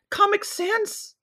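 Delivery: squeaky, very high anime voice; overacted anime dub. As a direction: squeal it like a cutesy anime kohai. Anime senpai bit.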